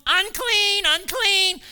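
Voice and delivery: shrill cry. Retold or imitated. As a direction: shriek it like a scandalised Pharisee. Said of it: "the problems that the Pharisees had".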